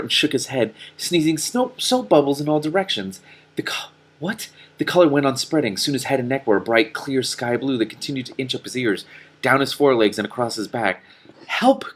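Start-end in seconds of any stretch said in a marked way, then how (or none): 3.5-4.5 baffled whisper. Real, not acted. Reader's baffled aside. 11.5-11.9 high, loud cry for help. Acted. Donkey Killer crying out as he turns blue. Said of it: "Killer cried".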